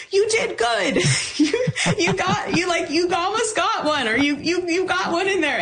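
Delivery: high-pitched imitation of fans cheering someone on, half laughing; encouraging fans. People around turn to console after a near goal, high mock-encouraging voices. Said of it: "turn around and be like".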